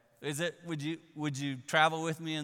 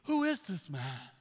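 slow, hushed amazement; awed wonder; 6 semitones higher, slower than usual. Retold or imitated. Disciples awestruck after the calm, slow. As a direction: let the wonder hang on each word. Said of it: "Look at their words".

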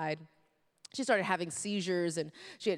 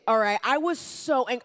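ordinary talk

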